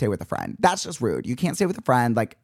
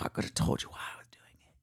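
whispered; 14 dB quieter than usual. Real, not acted. Exhausted climb down hotel staircase.

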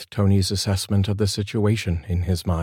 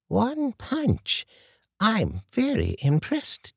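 put-on higher, steady older lady's voice; composed older woman Martha; much slower than usual. A narrator's reading. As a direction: calmly warn the gunman as an old lady.

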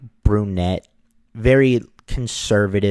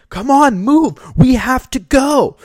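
high, loud impatient shout; yelling; 6 dB louder than usual. Retold or imitated. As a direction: yell at the car ahead to move. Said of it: "He's yelling".

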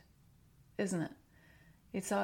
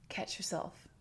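a soft unvoiced aside; whisper; 5 dB quieter than usual. Real, not acted. Coach to parent, a whispered self-check. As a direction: breathe it as a private reminder.

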